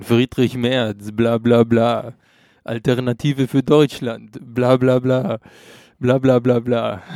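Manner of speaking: imitierter russischer Akzent, langsam und nachdrücklich. Retold or imitated. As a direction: Wassili mit russischem Akzent nachmachen. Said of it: "äh, russischem Akzent sagen". (mit gespieltem russischem Akzent, gedehnt)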